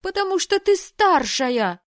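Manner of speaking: high, loud, slow shout; bitter jealous yell; angry shout. Acted. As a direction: hurl it at her. Sister shouts accusation.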